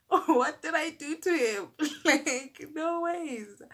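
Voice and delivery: high, loud, laughing protest; incredulous laughing. Real, not acted. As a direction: laugh through the words in disbelief. Incredulous laugh at her treatment.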